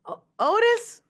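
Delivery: high, loud startled question; startled disbelief. Retold or imitated. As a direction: yelp it in disbelief. Mistakes [name] for a friend.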